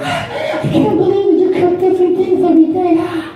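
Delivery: very high, gushing mock-wife voice; swooning wife. Wife overcome by husband's home cooking, high gushing disbelief. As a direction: squeal in hammed-up amazement and arousal. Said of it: "my wife's fucking wet".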